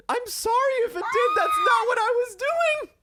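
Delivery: high, loud, laughing wail of apology; panicked protest. Real, not acted. Host accused of an Indian accent, panicked loud protest. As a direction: plead innocence at a high-pitched shout, stretching it out.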